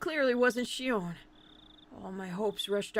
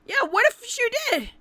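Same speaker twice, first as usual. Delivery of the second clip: high, loud burst of indignation; agitated retort; 11 semitones higher, 7 dB louder than usual. Acted. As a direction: throw it back, high and heated. Voices agitated inner thought.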